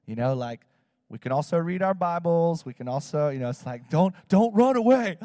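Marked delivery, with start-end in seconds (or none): 3.9-5.2 put-on loud, raised call after the kids; pleading shout to nervous teens; urgent plea. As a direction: call out not to bolt. Pleading with kids not to leave.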